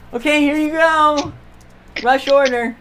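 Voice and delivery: high, loud shop-worker voice; harried shop worker. Acted. Bandaged seamstress presents the order to customers, harried shop-worker voice.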